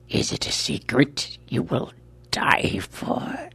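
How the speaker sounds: high whispered threat, slow; cold threat. Acted. Albino threatens with gun.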